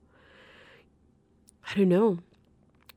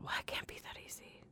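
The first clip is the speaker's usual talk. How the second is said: whispered, fast doubting thought; inner thought; 18 dB quieter, faster than usual. Retold or imitated. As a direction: whisper it to yourself, skeptical.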